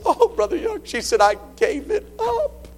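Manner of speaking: high, teary mock sob as he retells her; imitating her crying. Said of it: "She started crying".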